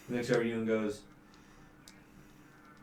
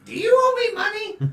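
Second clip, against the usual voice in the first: much higher, louder, squeaky suspicion; suspicious squeak; 12 dB louder than usual. Acted. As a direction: squeal the question. NPC suspicious question.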